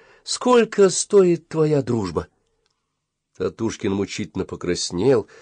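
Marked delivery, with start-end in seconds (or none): 0.2-2.3 slow, high, challenging question; defiant. A narrator's reading. Boy asks the price of friendship, defiant. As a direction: draw out the challenge, pitched up. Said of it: "сказала с вызовом".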